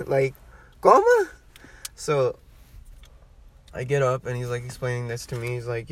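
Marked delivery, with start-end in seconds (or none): 0.8-1.3 very high, loud mock-baffled squeal; comic falsetto disbelief; acted bewilderment. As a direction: squeak it like you can't believe it. Acted baffled reply to caller.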